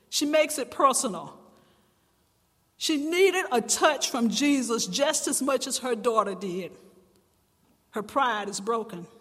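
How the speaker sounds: normal talking voice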